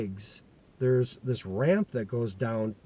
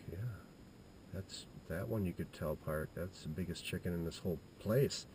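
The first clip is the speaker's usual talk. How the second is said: soft, lowered voice; hushed agreement; 6 semitones lower, 12 dB quieter than usual. Retold or imitated. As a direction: murmur it in awe, under the breath. Boy quietly agreeing with friend.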